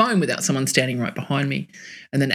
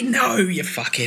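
normal talking voice

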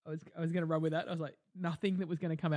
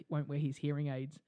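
ordinary delivery

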